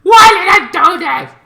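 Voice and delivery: high, loud shouted question; hammed-up high cry; mock anguish. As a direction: wail it high. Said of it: "I'm like".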